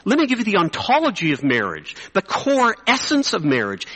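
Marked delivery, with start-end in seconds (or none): none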